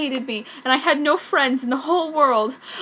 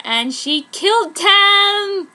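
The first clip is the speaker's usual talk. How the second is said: slow, higher and louder triumph; gleeful; 8 semitones higher, 8 dB louder, much slower than usual. Acted. Author gloating that her heroine killed the hackers. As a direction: relish every word.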